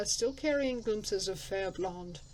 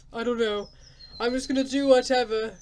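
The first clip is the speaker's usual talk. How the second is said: exasperated outburst; 3 semitones higher, 8 dB louder than usual. Real, not acted. Giving up on choosing.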